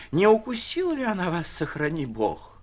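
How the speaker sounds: выше и громче, в тревоге; приживалки боятся за барыню, испуганно; испуганная суета. A narrator's reading. (тревожная причитающая интонация)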